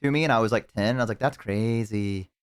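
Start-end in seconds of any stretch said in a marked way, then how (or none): 1.2-2.3 slow, drawn-out token reaction; imitated half-interested kid; feigned interest. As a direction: stretch it out, politely humoring them. Kid humoring divorce talk.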